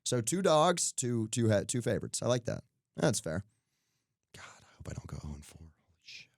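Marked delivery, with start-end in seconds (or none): 4.4-6.3 unvoiced whisper to himself; whispered aside. Real, not acted. Bettor fretting over going winless, whispered. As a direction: whisper it under your breath. Muttered worry about his picks.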